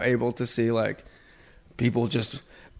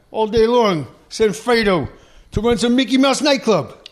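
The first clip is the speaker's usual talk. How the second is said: higher, louder mobster rant; Godfather impression; 8 semitones higher, 4 dB louder than usual. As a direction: play the wronged brother, louder and higher. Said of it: "in The Godfather".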